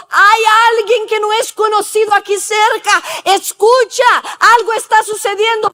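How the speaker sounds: urgent high-pitched voicing of a dog's alarm; warning dog. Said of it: "El perro que no dejaba de ladrar estaba diciendo". (perro que avisa, voz aguda y urgente)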